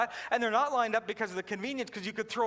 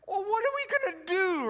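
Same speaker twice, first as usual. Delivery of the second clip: higher, cajoling Southern call; coaxing waitress; 7 semitones higher than usual. Retold or imitated. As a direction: wave them in, sing-song and high. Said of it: "waitress calling customers over".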